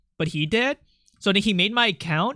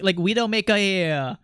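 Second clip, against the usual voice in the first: normal talking voice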